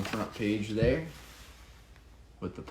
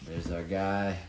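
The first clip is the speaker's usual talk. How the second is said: ordinary delivery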